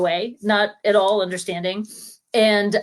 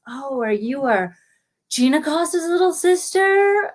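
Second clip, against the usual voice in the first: delighted teacher; 6 semitones higher than usual. Retold or imitated. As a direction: imitate a teacher thrilled to recognize the family. Imitates delighted teacher reading roster.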